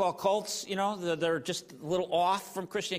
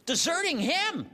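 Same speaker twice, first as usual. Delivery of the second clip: higher, indignant; exasperated Paul; 8 semitones higher than usual. Retold or imitated. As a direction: scold them like a betrayed teacher. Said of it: "Paul's like".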